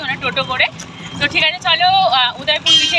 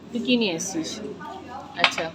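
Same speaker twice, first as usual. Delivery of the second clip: ordinary talk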